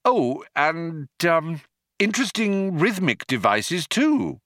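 high, hesitant praise; bluffing Arthur. A narrator's reading. Arthur flattering the Vogon's verse, hesitant.

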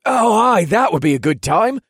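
high, loud, hopeful chiming in. A narrator's reading. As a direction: join in with eager hope. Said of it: "added in a hopeful tone".

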